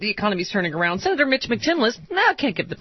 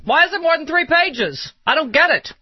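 high, raised-voice ranting; mock yelling; 10 semitones higher, 4 dB louder than usual. Pretends to yell at the president on Fox, mock yelling. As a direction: yell it in high-pitched indignation. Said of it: "So Bill O'Reilly can yell at him".

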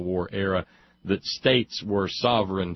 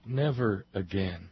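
soft, falling away to near silence; hushed solemn refrain; 6 dB quieter than usual. Acted. Voicing the blood of the war dead, hushed and solemn.

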